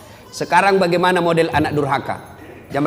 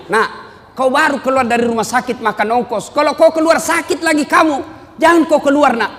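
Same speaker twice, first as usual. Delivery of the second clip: raised-pitch parental telling-off; mock motherly warning, rising higher; acted scolding mother; 8 semitones higher than usual. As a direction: scold your child, voice climbing. Mother scolding son who wants out.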